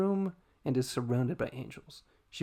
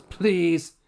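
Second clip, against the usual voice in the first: high, loud dismissive scoff; haughty scoff; 9 semitones higher, 13 dB louder, much slower than usual. Acted. Pride scoffing loudly at Fury. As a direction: brush her off with a loud scoff. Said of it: "I added that".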